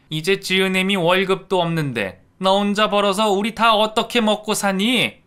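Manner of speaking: loud, high huffy scolding; indignant scolding. Acted. Stepmother indignantly scolding her stepson. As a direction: scold him as an ungrateful son.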